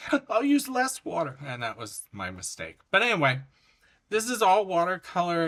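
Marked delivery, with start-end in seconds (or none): 0.1-1.4 high-pitched, flustered mock panic; squeaky play-acted fluster; retold panic. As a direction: panic out loud, voice shooting way up. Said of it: "I, I panicked".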